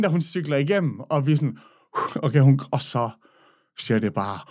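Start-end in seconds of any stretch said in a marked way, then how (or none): none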